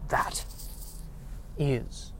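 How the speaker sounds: breaking it up with long pauses, whispered; very soft, word-by-word emphasis; solemn hushed praise. Acted. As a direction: whisper it with grave conviction. Repeating awed praise with solemn pauses.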